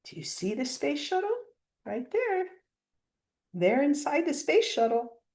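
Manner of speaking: usual speaking voice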